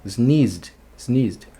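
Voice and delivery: sneezed with a quick D after Z; D rápido. Shown as a demonstration. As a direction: end sneezed in "zd", one syllable. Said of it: "faz um sonzinho de D". (sneezed com D rápido no fim)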